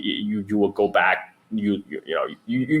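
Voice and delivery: ordinary talk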